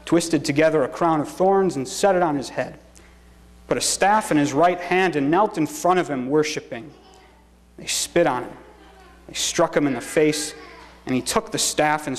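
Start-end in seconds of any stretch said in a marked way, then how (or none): none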